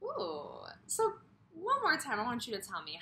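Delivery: ordinary talk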